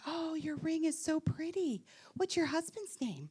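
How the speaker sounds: softly gushing woman's voice; gushing stranger. Retold or imitated. Stranger admiring her ring in the grocery store, lightly voiced gushing. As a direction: mimic a stranger's soft small-talk gushing.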